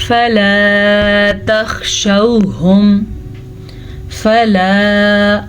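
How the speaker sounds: chanted فَلَا, short "fa" and long held "laa"; stretched alif madd. Shown as a demonstration. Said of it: "اسے ہم کھینچ کے پڑھیں گے". (فَلَا میں لام الف کھینچ کر)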